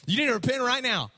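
punchy mock fire-and-brimstone; hammed-up preaching. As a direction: call sinners out like a mock street preacher. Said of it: "he preaches to the people".

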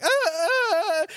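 high hammed-up sobbing; mock wail of a heartbroken girl; mock crying. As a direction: fake girly sobs. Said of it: "they're like".

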